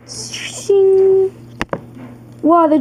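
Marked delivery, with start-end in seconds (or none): 0.1-1.3 whispered metallic shing; sword shing effect. Pretend. Dragon drawing a blade before the fight, whispered shing. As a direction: hiss out a blade being drawn.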